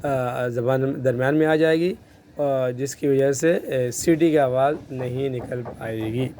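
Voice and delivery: usual speaking voice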